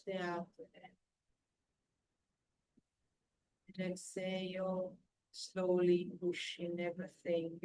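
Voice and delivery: calm breathing guide, unhurried; guided meditation. Acted. Leads a guided breathing relaxation.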